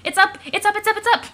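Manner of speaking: high, loud, rapid-fire cries; frantic high-pitched announcing; excited shouting. Acted. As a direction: burst out with urgent glee. Excited shouting about the mailbox.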